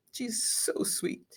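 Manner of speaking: soft fond whisper; whispered fondly about her sister; whispered aside. Real, not acted. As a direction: breathe it out as a tender secret.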